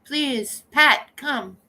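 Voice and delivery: ordinary talk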